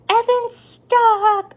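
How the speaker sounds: high, loud and slow alarmed call; drawn-out high cry for mom; retold shout. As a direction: wail it out as the scared big sister. Daughter calling out in alarm.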